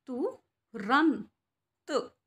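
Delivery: तु रंत split into two slow syllables. Shown as a demonstration. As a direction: say तु, pause, रंत. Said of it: "अंक की बिंदी की आवाज़ आ रही है". (तु रंत को अलग-अलग बोलना)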